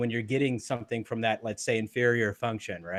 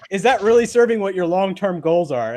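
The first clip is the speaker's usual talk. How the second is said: higher and louder, pressing question; loud pointed question; 7 semitones higher, 8 dB louder than usual. Retold or imitated. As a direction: challenge him loudly but friendly. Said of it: "he was like".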